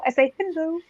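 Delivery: high, soft lolcat-speak greeting; internet animal voice. Shown as a demonstration. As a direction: say hello like a cutesy internet animal. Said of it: "I talk like an internet animal".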